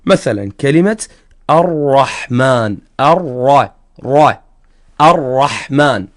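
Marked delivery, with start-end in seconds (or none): 1.5-6.1 slow "الرحمن" and "را" with a heavy R; tafkhim ra. Shown as a demonstration. Teacher giving an example of open ra, heavy demonstration. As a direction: lengthen and thicken the R in "الرحمن", then isolate it as "را". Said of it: "تفخم الراء".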